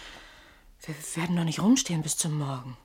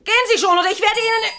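much louder, high, threatening yell; furious shout; 12 dB louder, faster than usual. Acted. Man yells at the intruder behind the door to leave, escalating to a furious shout. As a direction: bellow the threat through the door.

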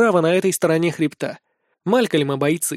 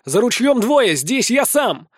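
raised, higher tactical call; calling to allies; 5 semitones higher, slower than usual. A narrator's reading. Fighter directing his allies mid-dodge, calling out. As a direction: call it out to your own side. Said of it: "подсказываю своим".

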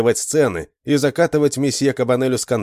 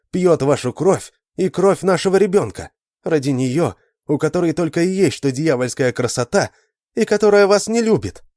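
jealous outburst; 5 semitones higher than usual. Acted. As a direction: spit out jealous accusations, building. Adele's jealous outburst to monsieur.

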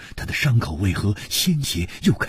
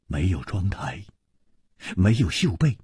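ordinary delivery

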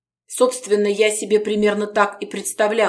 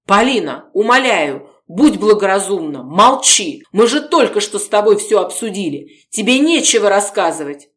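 loud pleading; 3 semitones higher, 6 dB louder than usual. Acted. Gorodetsky begging Polina to stay silent.

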